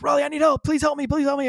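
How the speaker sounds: high, urgent radio plea; yelling for help. Retold or imitated. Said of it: "I yell really loud".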